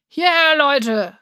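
high, loud long-distance call; shout from the back. A narrator's reading. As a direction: holler across a crowded hall. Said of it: "eine Stimme von ganz hinten".

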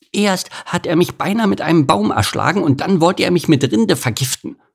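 high, indignant small-animal voice; aggrieved squirrel. Acted. Squirrel tells opossum of the beaver's misdeeds, aggrieved.

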